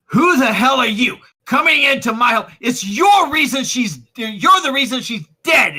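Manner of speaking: high, loud enraged shouting; raging, grief-fuelled outburst; furious accusation. Acted. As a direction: blame them for her death at full volume. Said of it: "such anger in his eyes".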